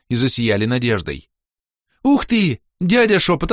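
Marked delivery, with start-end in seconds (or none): 2.0-3.5 put-on high, thrilled demand from a teen girl; excited girl. A narrator's reading. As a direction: squeal it out, delighted.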